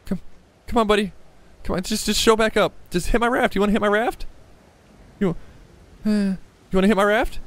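high-pitched coaxing, as if to a pet. Pretend. Player asks the shark to hit the raft, high coaxing. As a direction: bait it with a high, friendly wheedle. Baiting the hidden shark to attack.

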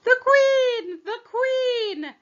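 high, loud alarmed cry of The queen; calling out in alarm. Acted. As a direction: shout the warning across the garden, high and loud. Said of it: "called out".

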